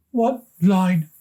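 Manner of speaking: put-on high, small boy asking hopefully; a young boy's eager question; little brother voice. A narrator's reading. Little brother asks his big brother, high boyish voice.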